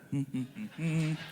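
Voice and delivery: soft closed-mouth mock chuckle; hummed, hushed villain's chuckle; low menacing chuckle. God imitated relishing the whip, low chuckle. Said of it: "God calmly".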